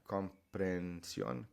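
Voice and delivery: comprensión said slowly, each syllable clear; slow Spanish demo. Shown as a demonstration. Teacher modelling comprensión slowly for students. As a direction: stretch out comprensión for the learner. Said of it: "It's just one long eh sound".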